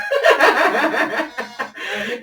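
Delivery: long rising laugh; rising amused laughter. Real, not acted. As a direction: laugh at the irony. Laughs at DoorDash irony.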